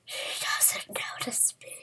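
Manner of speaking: hushed sneaky whisper. Pretend. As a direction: whisper to stay hidden.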